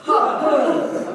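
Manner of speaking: explosive belly grunt; getting-hit grunt. Shown as a demonstration. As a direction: blast it out as if punched. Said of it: "Pretend you're getting hit".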